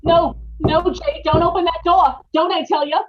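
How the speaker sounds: loud, high panicked plea; frightened pleading. Acted. Wife begging her husband not to open the basement door, loud and panicked. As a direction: beg him not to open it.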